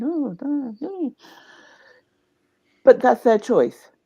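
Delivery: normal talking voice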